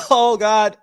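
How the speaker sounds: choked up after a smoke; mid-coughing fit. Real, not acted. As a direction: groan it out while coughing.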